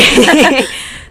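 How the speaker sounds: sudden high burst of laughter; embarrassed laugh. Real, not acted. Embarrassed apology over quiet mic.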